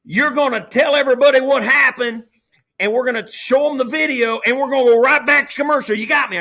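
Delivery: very loud, high South Texas director imitation; imitated director hollering urgent orders into a headset; Lance Barrow. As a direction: shout the game plan like a Texan TV boss. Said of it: "'cause he's from South Texas and stuff".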